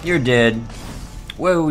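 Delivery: ordinary talk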